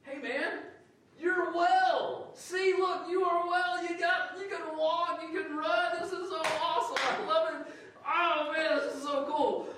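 very high, loud, gushing joy; bubbling over with delight; excited Jesus. Retold or imitated. Jesus greeting the healed man, very high and loud with joy. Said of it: "and he goes".

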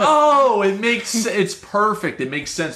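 high surprised "oh" settling down; sudden dawning delight; excited realization fading to calm. Retold or imitated. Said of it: "it's like".

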